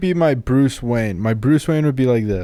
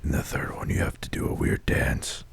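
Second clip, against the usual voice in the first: whispered Batman voice; 9 dB quieter than usual. Pretend. Mock Batman voice joke.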